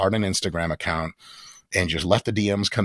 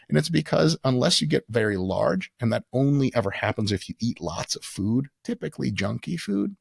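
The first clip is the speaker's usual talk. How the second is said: ordinary talk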